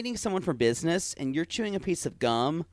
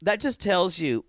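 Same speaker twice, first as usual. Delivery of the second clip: ordinary delivery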